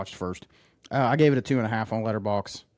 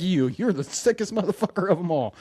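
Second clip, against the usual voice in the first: higher put-on voice; mock accusation; 7 semitones higher than usual. Retelling the party scene in a higher mock accusing voice. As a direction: call him out like in the movie. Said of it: "He's like".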